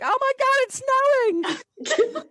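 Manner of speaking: very high, quick, loud squeal; excited squeal. Retold or imitated. Retelling her first snow in Canada, a very high excited squeal. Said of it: "I'm like".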